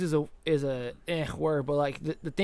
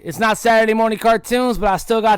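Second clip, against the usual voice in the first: announcer voice; 6 semitones higher, 9 dB louder than usual. Acted. Podcast intro to listeners.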